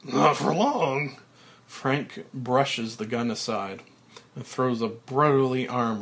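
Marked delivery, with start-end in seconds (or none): 0.0-1.2 smiling menace. Acted.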